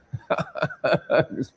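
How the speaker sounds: real breathy chuckle in a quick run; laughing. Real, not acted. Teasing Nathan about being past thirty, laughing. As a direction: burst into amused laughter.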